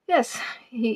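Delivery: usual speaking voice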